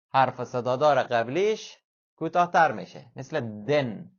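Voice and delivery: normal talking voice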